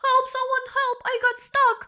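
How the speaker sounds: high-pitched imitation of someone trapped; mock-desperate, squealed plea; retold cry for help. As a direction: mimic a panicked plea in a very high voice. Acting out trapped woman's plea.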